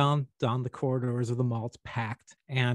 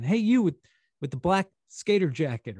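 put-on high call with a girlish lift; 6 semitones higher, 5 dB louder than usual. Girl at the store calling the boy in the jacket, higher. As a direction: call him over again. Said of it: "I hear it again".

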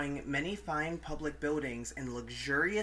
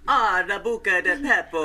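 hammed-up Italian accent, louder; mock Italian accent; 5 semitones higher, 9 dB louder than usual. Friends joking about Pompeii, in hammed-up Italian accent. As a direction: play a cartoon Italian waiter.

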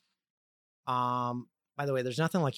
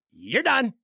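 mock boss, much higher and louder; curt boss; 10 semitones higher, 6 dB louder than usual. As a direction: snap it out like the boss giving the sack. Boss firing him, quoted.

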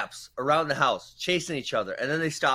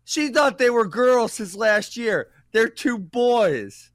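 slow, higher, drawn-out punchline; savoring the twist; slow reveal; 8 semitones higher, 4 dB louder, much slower than usual. Real, not acted. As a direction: draw out the reveal for the laugh.

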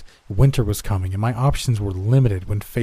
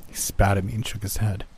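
plain reading voice

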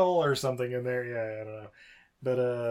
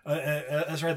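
ordinary delivery

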